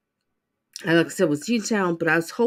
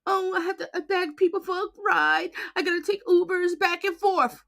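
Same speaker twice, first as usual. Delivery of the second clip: mocking whine; 9 semitones higher than usual. Commentator mimicking the defendant's TikTok complaints, mocking. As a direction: parrot her complaints in a whiny voice.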